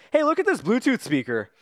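bright, excited high voice; goofy high voice. Retold or imitated. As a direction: sound thrilled and silly. Imitating Valkyrie's goofy line.

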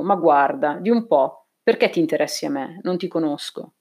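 ordinary delivery, nothing marked

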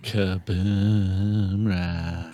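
low, very slow dragged-out brand name; slowed-down low echo of the sponsor name; deep slowed echo. Acted. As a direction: drop it deep and stretch it out.

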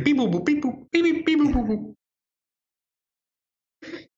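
high beeping robot voice; toy robot voice. Retold or imitated. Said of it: "Chris is like".

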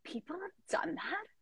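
whispered disbelief, rising; stunned whisper. Retold or imitated. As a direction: hush it out in shock. Disbelief at idea already existing.

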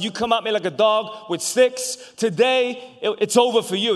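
high, loud mocking challenge; taunting shout. Goliath taunting David before the fight, loud and mocking. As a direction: jeer at the boy across the valley.

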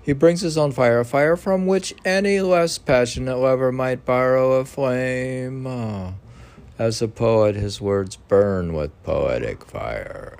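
an animated, declaiming text reading; formal reading. Acted. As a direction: read it out with rising energy. Continues reading commentary aloud.

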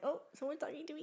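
quick, high, soft little voice; tiny perked-up voice, imitated; voicing the listener's thought. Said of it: "and he was like".

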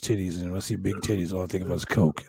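low-pitched confessional impression; a hint of a low wrestler voice; Jake the Snake Roberts. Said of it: "And he's like".

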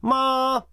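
まあ held high and level; first tone. Shown as a demonstration. Teacher listing four tones, high level まあ. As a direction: hold まあ on one high note. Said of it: "第一声から第四声まであります".

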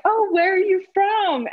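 high, bright mock question from a stranger; curious stranger. Stranger at a Cape Town restaurant asks, high mock-curious voice. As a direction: play a curious diner asking politely. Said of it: "And they were like".

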